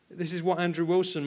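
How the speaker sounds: usual speaking voice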